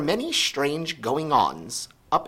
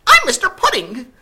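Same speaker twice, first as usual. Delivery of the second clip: very high, loud elf voice; Mister Pudding; 14 dB louder than usual. A narrator's reading. Elf introducing himself to Sophie, high and loud. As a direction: introduce yourself like a tiny fussy elf. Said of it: "he said".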